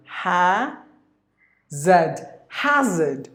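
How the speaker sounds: "Hazard" as HA... zed, then joined, no R; syllable-by-syllable British "Hazard"; British, two syllables. Shown as a demonstration. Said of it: "There are two syllables here".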